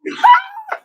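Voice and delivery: loud, high-pitched laugh; sudden shrill real laugh; high burst of laughter. Real, not acted. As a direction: let out a loud, high cackle. Speaker laughs before mocking the services.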